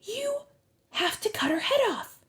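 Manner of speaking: put-on high-pitched girl's voice; high, halting insistence; urgent young girl. A narrator's reading. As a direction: urge a grim task, lightly voiced high. Annabeth voiced urging Percy.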